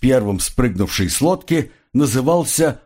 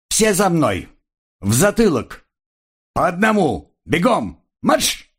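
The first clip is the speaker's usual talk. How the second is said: sharp drill orders, rising high; barked commands; 6 semitones higher than usual. A narrator's reading. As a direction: snap out orders to a squad. Instructor barks marching orders.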